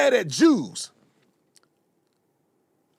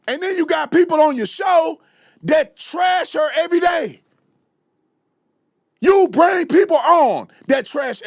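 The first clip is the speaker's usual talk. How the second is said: raised, heated yelling at him; angry shout; 6 semitones higher, 4 dB louder than usual. Real, not acted. Ranting at Tucker for not defending Erica, angry shout. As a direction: let the anger boil over, louder.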